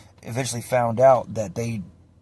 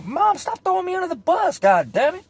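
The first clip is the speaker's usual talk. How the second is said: high, loud mock yell; exasperated protest; 6 dB louder than usual. Son begging mom in his head to stop, high exasperated yell. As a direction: plead loudly in a strained, high voice.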